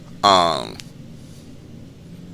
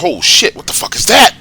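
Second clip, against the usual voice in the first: higher-pitched startled outburst; retold startled reaction; 10 semitones higher than usual. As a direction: act out a shocked discovery. Startled by a hand in his mouth.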